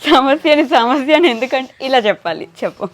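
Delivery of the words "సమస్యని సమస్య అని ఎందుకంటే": big demonstrative laughing delivery; laughing out loud. Shown as a demonstration. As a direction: open with a laugh, then a loud line. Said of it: "అదే నవ్వుతూ చెప్పాలి పెద్దగా".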